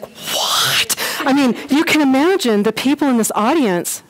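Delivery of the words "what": breathless shocked what; a whispered startled what; stunned what. Retold or imitated. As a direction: gasp out a disbelieving what. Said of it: "you're just like".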